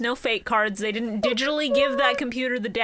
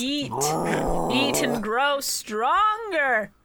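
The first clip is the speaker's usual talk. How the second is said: slow menacing command; much slower than usual. Retold or imitated. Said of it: "he just goes".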